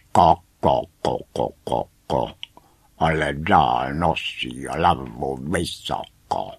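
staccato clucks into a low jeer; clucking hens. A narrator's reading. Hens joining in to tease the sisters, clucking. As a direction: cluck the taunt like a hen. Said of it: "A slepice dodávaly".

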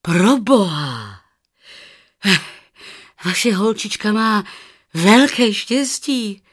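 excited, emphatic outburst, high and loud; astonished exclamation. A narrator's reading. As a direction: exclaim the wonderful news at full voice.

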